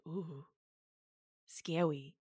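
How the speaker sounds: low, very soft little shudder; mock shiver. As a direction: murmur it in playful dread. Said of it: "I'm always like".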